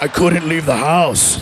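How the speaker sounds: low, slow put-on voice; slow, deep mock big-man voice; heavy man voice. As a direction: drop low and drag it out. Low put-on voice of huge self.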